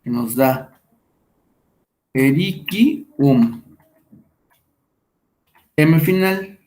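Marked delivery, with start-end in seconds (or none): 2.1-3.6 "Erikium" stretched out syllable by syllable; very slow, deliberate dictation of "Erikium"; word shown slowly. Shown as a demonstration. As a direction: sound out "Erikium" for the class. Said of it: "Vamos a ver esa palabra".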